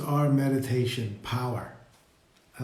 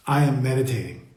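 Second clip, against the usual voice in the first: "I am meditating" as a plain fact, pitch falling at the end; spoken as a statement. Shown as a demonstration. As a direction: let "meditating" drop to a full stop. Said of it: "as a statement".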